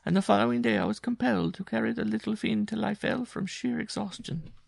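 a worn-out woman telling her hardship; Jean's voice. Acted. Jean tells of escape attempt.

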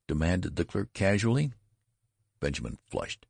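usual speaking voice

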